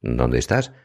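usual speaking voice

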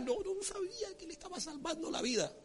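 high, very soft preacher's repeat; hushed emphasis. Real, not acted. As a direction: say it again in a high hush. Same line repeated in hushed voice. (en voz aguda y muy bajita, con asombro)

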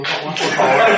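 begging mom on the phone, half laughing; suffering plea. Retold or imitated. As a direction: plead like you can barely hold it. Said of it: "pero ya casi sufriendo". (súplica desesperada entre risas)

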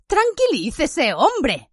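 high, slow exclamation; moderate shout. A narrator's reading. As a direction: call for calm, agitated. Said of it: "gritó Fudge".

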